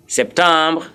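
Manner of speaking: first "September" as French sep-tahm-bruh; French pronunciation. Shown as a demonstration. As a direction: say "September" as French septembre, nasal middle vowel. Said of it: "September is your September".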